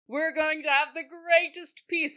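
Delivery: grand proclamation. Retold or imitated. Lords announcing their peace, grandly proclaiming. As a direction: proclaim it like a lord. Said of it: "proclaiming how great their peace will be".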